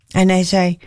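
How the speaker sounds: ordinary delivery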